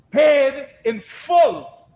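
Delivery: "Paid in full" shouted slowly, each word hammered; triumphant shout. Acted. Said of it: "The Greek word tetelestai".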